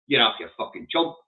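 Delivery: ordinary talk, nothing marked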